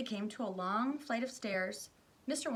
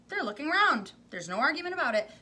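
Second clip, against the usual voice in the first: high, loud and quick with glee; triumphant insistence; 7 semitones higher, 10 dB louder than usual. Acted. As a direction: crow the clever point. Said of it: "he cried triumphantly".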